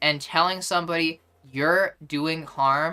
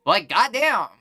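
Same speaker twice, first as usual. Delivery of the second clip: higher, emphatic mock scolding; mock concerned accuser; 6 semitones higher, 4 dB louder than usual. Streamer acting out a stranger accusing someone in public, mock concerned. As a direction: overplay the self-righteous concern. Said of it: "And I go".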